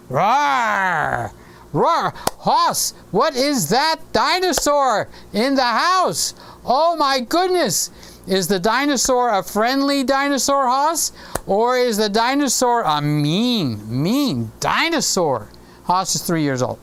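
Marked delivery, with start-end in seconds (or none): 0.1-2.1 playful mock dinosaur roar. Grandpa roars as the three-foot dinosaur, playful mock roar. 2.4-15.5 higher, slower, mock-amazed child talk; talking to a toddler. Grandpa asks his three-year-old about the dinosaur, higher and slower mock amazement.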